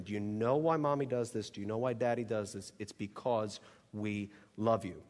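mommy talking softly to her son; gentle parent voice. Shown as a demonstration. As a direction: lean in and reassure him quietly. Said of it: "we say something like".

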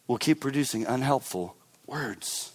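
normal talking voice

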